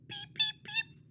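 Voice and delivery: put-on baby chick cheeps; chick peeps. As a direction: peep like a tiny chick. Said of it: "that's what they say".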